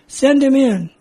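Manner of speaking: called-out order. Retold or imitated. Lieutenant calling to the duty sergeant from his office, loud. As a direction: call it through to the desk.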